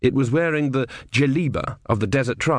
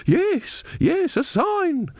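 put-on much higher, slow voice of a young woman; eager young woman, higher and slowed; much slower than usual. A narrator's reading. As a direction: pitch up high and draw out the sign. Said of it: "said a young woman next to him".

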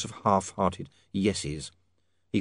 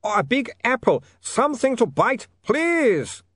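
high, slow pleading vampire wail; desperate moaning; 14 semitones higher, 4 dB louder, slower than usual. A narrator's reading. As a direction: beg desperately in a high accented voice. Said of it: "he moaned".

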